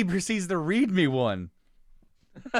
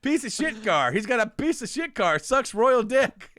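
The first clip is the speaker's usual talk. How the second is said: mock angry reading; 6 semitones higher than usual. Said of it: "the way he's angrily reading".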